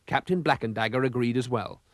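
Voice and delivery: plain reading voice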